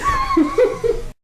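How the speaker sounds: very high little laugh; tiny high laugh; squeaky high giggle. Real, not acted. Squeaky laugh after joke.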